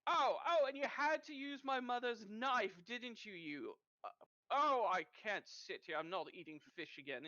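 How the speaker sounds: a spoiled man throwing a fit over dinner; whiny complaint. Acted. Man whines about mother's knife.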